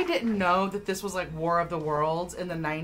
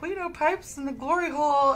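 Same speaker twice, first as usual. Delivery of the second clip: mock posh British accent, higher; 9 semitones higher, 4 dB louder than usual. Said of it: "bunch of posh British people".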